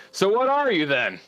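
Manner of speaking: high-pitched, blunt questioning voice; Lily. Acted. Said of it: "Lily says".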